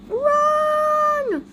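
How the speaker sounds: long, high-pitched drawn-out call. Acted. Reader acting out the chase, calling out high. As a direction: stretch the word out high. Acted action word, drawn out.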